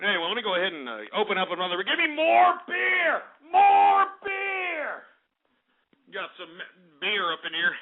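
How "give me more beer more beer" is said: high, drawn-out call for more; demanding holler. Pretend. Host calling for another drink, drawn-out holler. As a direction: holler it slowly, stretching each word.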